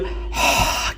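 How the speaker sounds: airy unvoiced haah from the middle of the throat, like after a cold drink; relieved breathy haah after a cold drink. Shown as a demonstration. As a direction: exhale a refreshed haah from the throat. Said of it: "की आवाज़ निकली तो ये आपको middle throat से".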